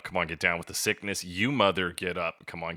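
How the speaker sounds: ordinary talk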